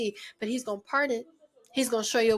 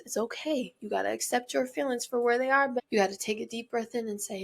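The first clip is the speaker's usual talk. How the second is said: usual speaking voice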